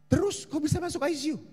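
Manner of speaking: high, fast puzzled asking; surprised question. Retold or imitated. Pastor asks the mother about the ICU, surprised. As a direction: ask in disbelief.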